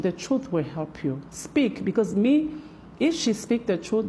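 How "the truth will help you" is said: ordinary delivery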